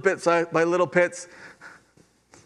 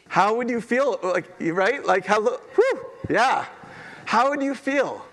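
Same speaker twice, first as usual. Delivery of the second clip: awed excitement; 7 semitones higher than usual. Real, not acted. Pastor asking the congregation, awestruck and lifted. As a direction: let the wonder lift the voice.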